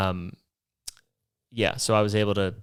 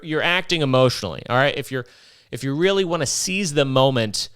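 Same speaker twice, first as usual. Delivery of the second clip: normal talking voice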